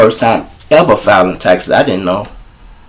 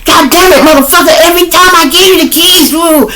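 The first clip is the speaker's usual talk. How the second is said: high, loud shrill-free mock nag; nagging girlfriend; 4 dB louder than usual. Girlfriend scolding her man about the empty tank, high and loud. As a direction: screech the complaint at him.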